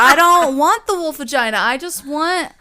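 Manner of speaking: high, loud exasperated mock rant; mock coffee rant. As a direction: rant like a fed-up customer. Ranting at fancy coffee shop.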